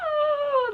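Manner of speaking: high, crying "oh"; long high whimper of emotion; tearful wail. Real, not acted. Reader moved by the character being accepted, tearful wail. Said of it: "it's making me so emotional".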